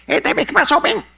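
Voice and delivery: high, loud, fast Cuban greeting; Cuban Spanish greeting. Retold or imitated. Latin guys greeting him in Cuban Spanish. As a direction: toss it off like a Havana street greeting. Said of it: "they said".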